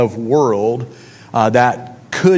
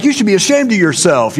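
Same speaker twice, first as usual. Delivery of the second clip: raised, higher indignant retort; retold yelling; 6 semitones higher, 3 dB louder than usual. Retelling a scam phone call, yelling back indignantly. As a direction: snap back at the scam caller, higher and louder. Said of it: "And I was yelling at him".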